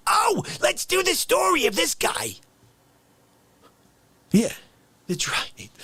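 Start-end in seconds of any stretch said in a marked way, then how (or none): 0.1-2.3 mock excitement. Imitating future novelists.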